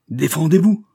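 loud, high furious command; angry challenge. A narrator's reading. Banker orders Raoul to take the revolver, furious command. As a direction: order him to fight. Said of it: "poursuivit le banquier en élevant son arme".

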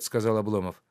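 usual speaking voice

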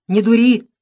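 stern order. A narrator's reading. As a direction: scold the boy firmly. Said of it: "строго приказал дед Никита". (строго приказывает)

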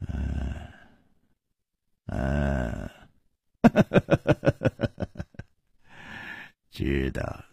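a hint of tired villain; weary sigh. A narrator's reading. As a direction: sigh out the words, beaten. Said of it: "南宫灵才叹了一口气".

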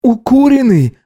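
high, stunned question; incredulous high-voiced query; dazed disbelief. A narrator's reading. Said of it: "ошалело спросил Гидеон".

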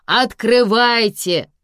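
loud, slow, drawn-out command; insistent order. A narrator's reading. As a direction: stretch out the order to open up. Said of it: "велели из коридора".

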